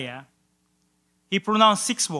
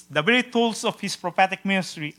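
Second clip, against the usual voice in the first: ordinary talk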